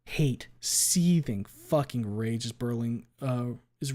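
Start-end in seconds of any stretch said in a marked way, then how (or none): none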